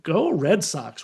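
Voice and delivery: slow, grudging go Red Sox; reluctant cheer. Retold or imitated. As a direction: drag out "Go Red Sox" like it hurts to say. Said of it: "a thing I never thought I would say".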